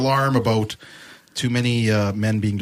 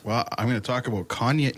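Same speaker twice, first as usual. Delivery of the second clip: ordinary delivery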